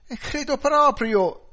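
loud, high, drawn-out wail; sobbing outcry. A narrator's reading. Wife confirms husband's death to police, wailing high and loud. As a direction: wail it at the top of your voice. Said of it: "singhiozzò lei".

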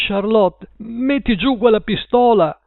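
high-pitched, urgent appeal; alarmed plea. A narrator's reading. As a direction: plead with someone holding a gun. Man pleading with armed woman. (supplica allarmata, voce acuta)

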